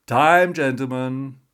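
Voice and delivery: loud, high barman's last call; tired last call shout. A narrator's reading. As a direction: bellow time over the pub noise. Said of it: "The barman's voice cuts through".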